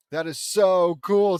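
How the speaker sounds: loud, drawn-out hammed-up awe; mock gushing. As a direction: belt it out slowly in overdone amazement. Acting out Vince's gushing line.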